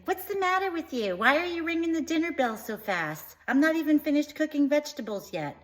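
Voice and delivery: shrill-free but high, scold like an annoyed mom. Retold or imitated. Mom scolding her kid for ringing the bell, high nagging. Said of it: "And then she's like".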